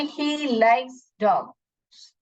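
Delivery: very slow, word by word; slow reading. Shown as a demonstration. Teacher reading a sentence slowly to children. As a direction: read it slowly for the class. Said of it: "कैसे पढ़ूंगी?".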